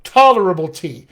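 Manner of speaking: Tolerable tea, TOL loud and strong; stress on TOL. Shown as a demonstration. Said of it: "Ta and T now become my stress syllables".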